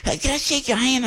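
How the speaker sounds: hammed-up high gush of praise; exaggerated gushing woman. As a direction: overdo the admiration like a starstruck fan. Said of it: "I'm with that exaggeration".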